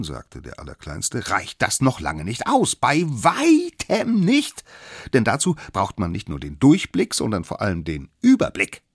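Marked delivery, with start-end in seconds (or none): 1.3-8.8 emphatic little expert, louder and pitched up; kleiner Besserwisser. Acted. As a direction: lecture them as the smallest one. Youngest figure lectures the others. (wichtigtuerischer Kleinster, laut und betont)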